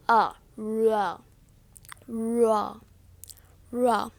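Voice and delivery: "ra" drawn out low and soft; low, soft demonstration of "ra"; untouched-tongue R. Shown as a demonstration. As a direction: glide into "ra", tongue touching nothing. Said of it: "Your tongue not gonna touch your teeth".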